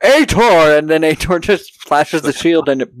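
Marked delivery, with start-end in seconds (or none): 0.0-0.8 calling out. Retold or imitated. Villain calls out to hero.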